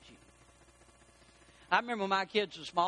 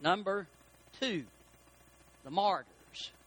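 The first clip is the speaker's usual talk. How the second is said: ordinary talk, nothing marked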